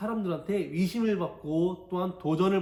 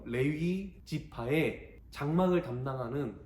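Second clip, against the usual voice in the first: normal talking voice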